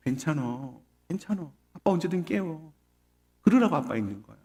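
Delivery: soft, soothing reassurance; gently pat a crying child with words; hushed retold tenderness; comforting father. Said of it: "애를 이렇게 다독거려줬어요".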